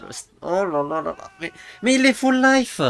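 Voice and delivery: high, loud astonished yell; shocked disbelief. Real, not acted. Shocked by a live outcome.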